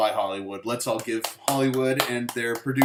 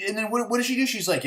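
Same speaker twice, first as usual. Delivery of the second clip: usual speaking voice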